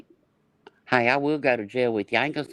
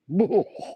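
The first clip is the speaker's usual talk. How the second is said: high laugh; 7 semitones higher than usual. Real, not acted. Laughing at the thought of him shirtless.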